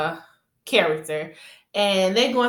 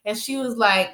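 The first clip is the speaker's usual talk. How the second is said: ordinary talk, nothing marked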